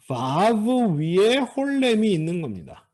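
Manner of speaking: normal talking voice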